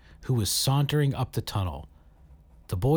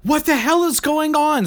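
high, loud panicked scream; 8 dB louder than usual. A narrator's reading. Saradwan to the group as they crash, a high panicked scream. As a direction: scream it as the ship falls apart. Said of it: "screamed Saradwan".

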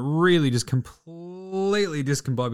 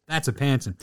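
usual speaking voice

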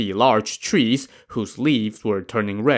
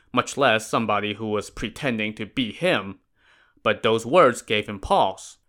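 ordinary delivery

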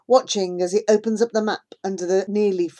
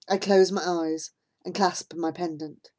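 ordinary delivery

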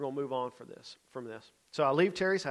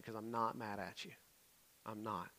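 soft, lower, reassuring voice; gentle reassurance; 4 semitones lower, 14 dB quieter than usual. Retold or imitated. Youth leader to apologizing Jonathan, softly reassuring. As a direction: let him off the hook quietly.

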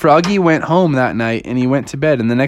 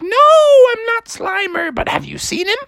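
high-pitched cartoon mother; indignant high mom voice; Froggy's mom. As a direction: answer in a high falsetto. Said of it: "And she's like".

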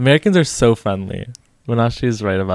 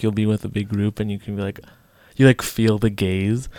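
ordinary delivery

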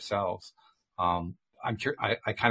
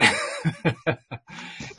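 real chuckling laugh, higher-pitched; amused laugh; 6 semitones higher than usual. Real, not acted. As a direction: laugh it out after the remark. Laughs after own remark.

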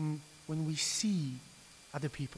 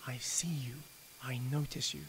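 hushed whisper; 3 semitones lower, 7 dB quieter than usual. Acted. God whispers to the unseen slaves, hushed. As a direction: whisper it, God's tender words.

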